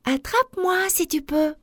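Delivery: high-pitched playful challenge; high storybook taunt; taunting little galette. A narrator's reading. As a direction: dare them in a high little voice.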